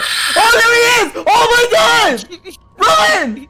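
high panicked yelling; panicking gamer. Real, not acted. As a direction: scream while being chased. Monster chasing him in game.

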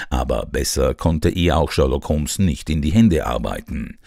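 ordinary delivery

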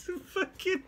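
breathless high cackle; real laughing fit; helpless high laughter. Real, not acted. Youtuber watching Nancy Cartwright's clip, helpless laughter.

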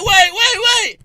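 high, loud pleading wait; mock panicked plea. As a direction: beg them to stop as you're pulled. Friend pleading while dragged back.

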